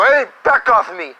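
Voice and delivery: sudden high, loud snap, played for laughs; mock angry outburst. Fake-gentle man snapping at someone, loud mock yell. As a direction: blow up after pretending calm.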